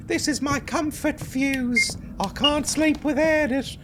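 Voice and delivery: put-on very high little English kid; English child voice. Child clinging to a comfort fuse, high English little-kid voice. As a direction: pipe up like a small English child. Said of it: "all the children in this are English".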